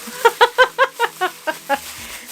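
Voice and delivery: high burst of laughter; real amused laugh. Real, not acted. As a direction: laugh at own joke. Joke about parents fighting.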